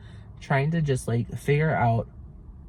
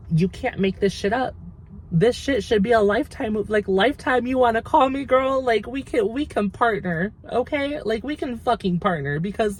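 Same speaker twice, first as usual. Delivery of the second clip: excited rant; 8 semitones higher than usual. Real, not acted. Ranting to viewers that nobody could make this up, excited and high. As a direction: gush like the story is too wild to believe.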